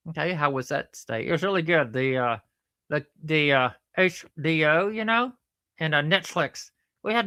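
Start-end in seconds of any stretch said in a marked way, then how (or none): none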